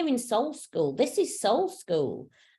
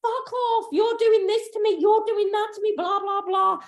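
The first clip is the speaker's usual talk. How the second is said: much higher, louder, shrill-edged parody of blaming others; mock angry rant; 13 semitones higher, 4 dB louder than usual. Mocking people who blame others after yoga, a high angry rant. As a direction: fling the blame out in a caricatured outburst. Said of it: "And then they're like".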